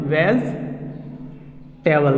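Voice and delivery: "वेजिटेबल" slowly split as vez... te-bal; low, slow "वेजिटेबल" without the middle "ee"; corrected pronunciation. Shown as a demonstration. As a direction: pause after "vez" in "वेजिटेबल". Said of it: "इसको direct हम लोग बोलेंगे".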